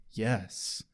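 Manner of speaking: low, hushed ominous yes. Retold or imitated. Tara answering whether something is following Willow, ominous. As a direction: drop it low and soft, full of foreboding. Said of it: "gives the most ominous".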